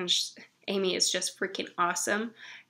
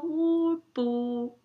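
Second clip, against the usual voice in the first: put-on toddler voice; 7 semitones higher than usual. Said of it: "she did her first two syllables".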